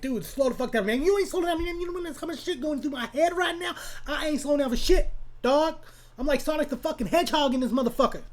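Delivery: high-pitched, fast comic rant; hyped-up, squeaky mock protest; manic inner argument. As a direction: rattle it off high, like a hyper speed freak. Acts out manic argument with himself.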